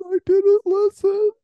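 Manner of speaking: very high, loud silly voice on didn't; high-pitched mocking whine; mock high squeal. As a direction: squeal it like a cartoon brat. Repeats line in silly voice.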